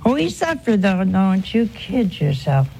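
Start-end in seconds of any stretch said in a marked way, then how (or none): none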